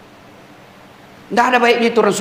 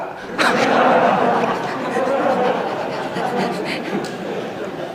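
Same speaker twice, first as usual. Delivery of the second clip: breathy silent laughter; laughing; 5 dB quieter than usual. Real, not acted. Preacher laughing at the inmate's drunk answer, breathy and unvoiced. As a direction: laugh hard without voice. Joke about tattoo drunk.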